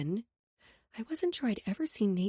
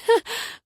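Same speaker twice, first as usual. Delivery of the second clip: high breathy burst of laughter; incredulous snort of laughter; 13 semitones higher, 7 dB louder than usual. A narrator's reading. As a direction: laugh out loud at the absurd idea. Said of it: "I started laughing".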